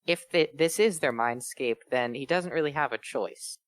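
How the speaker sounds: usual speaking voice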